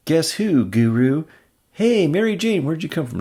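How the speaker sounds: playful, high teasing voice; teasing girlfriend. Acted. Gwen teasing Peter in a playful voice. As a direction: tease him like a girlfriend.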